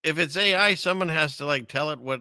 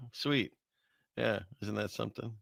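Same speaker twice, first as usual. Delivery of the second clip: ordinary talk, nothing marked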